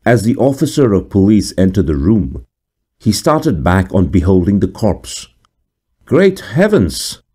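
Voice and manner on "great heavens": high, slow shocked cry; startled cry. A narrator's reading. Police officer seeing the corpse, high startled cry. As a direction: recoil at the sight. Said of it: "cried he".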